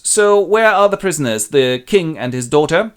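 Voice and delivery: loud, high challenge; demanding Albany. Acted. Albany asks Edmund where the prisoners are, loud.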